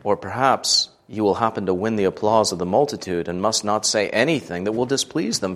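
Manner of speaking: ordinary delivery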